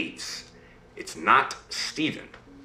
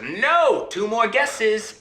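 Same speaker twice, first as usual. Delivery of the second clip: high, gleeful imp voice; laughing imp; 11 semitones higher, slower than usual. Acted. Imp mocks the princess's wrong guess, high gleeful voice. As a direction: crow it high and drawn out, as an imp. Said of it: "And he laughs".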